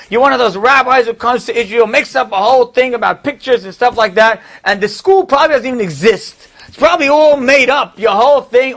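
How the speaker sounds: outraged rich man telling the rabbi to leave; retold angry yelling. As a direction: yell at him like he's a fraud. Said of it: "this person starts yelling at me".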